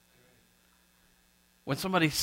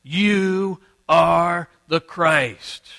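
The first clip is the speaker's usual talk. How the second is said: loud, ringing, very slow proclamation; declaring loudly; 3 semitones higher, 8 dB louder, much slower than usual. Acted. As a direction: proclaim each word at full force, drawn out. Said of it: "declaring loudly".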